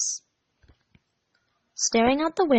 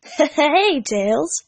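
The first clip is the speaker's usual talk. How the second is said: put-on louder grinning boy greeting; 5 semitones higher, 3 dB louder than usual. A narrator's reading. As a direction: say it with a cocky grin. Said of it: "Sonic grinned".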